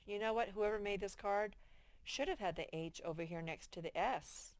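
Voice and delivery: ordinary talk, nothing marked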